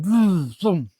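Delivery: two short buzzing vrooms; imitated radar noise. Reviewer voicing the comic's radar, imitated vroom. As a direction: mimic a machine whooshing twice.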